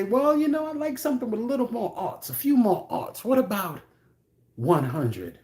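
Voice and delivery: high, fast put-on haggling voice; mock buyer voice. The buyer haggling with the seller in a high mock voice. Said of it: "they say".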